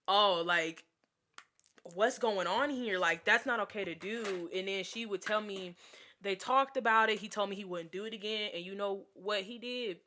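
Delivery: ordinary delivery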